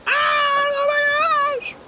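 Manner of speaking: slow, squealing pretend panic; mock panic scream. Shown as a demonstration. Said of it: "it's panic screaming".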